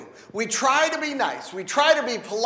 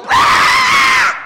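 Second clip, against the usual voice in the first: sudden furious yell, much higher and louder; explosive shout; 11 dB louder than usual. Pretend. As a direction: bark it out all at once. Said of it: "and you go".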